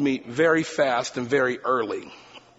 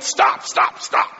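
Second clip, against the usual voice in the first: mock yell of stop, a bit louder; retold yell; 4 dB louder, much slower than usual. Professor cuts off the rambling student, mild mock yell. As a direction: cut the runaway talker off. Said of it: "And finally he yelled".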